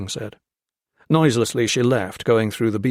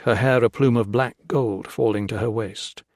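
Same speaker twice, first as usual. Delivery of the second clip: usual speaking voice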